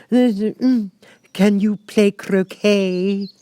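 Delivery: high, slow, lofty Queen voice; imperious Queen. A narrator's reading. The Queen questioning Alice, lofty and slow. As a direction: look down on the child from the throne.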